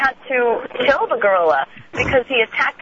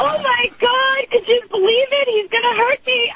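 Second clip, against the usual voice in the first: mother's way of talking; 11 semitones higher than usual. Retold or imitated. Daughter mimics mother scared of the gorilla, high wailing panic. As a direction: imitate mother wailing in alarm, pitched way up. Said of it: "'cause that's how she talks".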